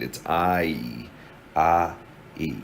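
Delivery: I broken into ah and e, each said alone; diphthong split apart. Shown as a demonstration. Teacher breaking a vowel into its sounds. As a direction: pull the diphthong apart slowly. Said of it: "multiple sounds combined".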